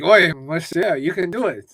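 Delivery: hammed-up French accent impression; Frenchie accent. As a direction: put on a rough French accent. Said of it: "This was my Frenchie accent".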